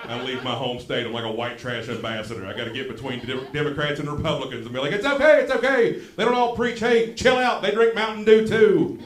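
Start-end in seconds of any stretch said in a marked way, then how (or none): 4.9-9.0 high, loud, hammed-up plea; frantic peacemaking shout. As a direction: holler over a fight to calm both sides. Pleading between two political sides.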